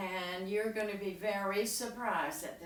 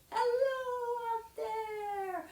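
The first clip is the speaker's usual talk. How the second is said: very high, drawn-out call; 4 dB louder, slower than usual. Shown as a demonstration. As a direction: call up to someone very tall. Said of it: "so you go".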